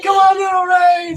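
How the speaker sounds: high, loud shouted encouragement; root for it at full pitch, reliving it; jubilant cheer. Retold or imitated. Cheering the ray on, retold.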